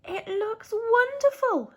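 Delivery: high, slow, delighted park keeper voice; gentle old park keeper, pleased; Percy the park keeper. Acted. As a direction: marvel at the snow as kindly Percy. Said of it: "said Percy the park keeper".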